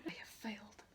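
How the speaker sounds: soft unvoiced line; dying whisper. A narrator's reading. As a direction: whisper it faintly. Boromir's dying words.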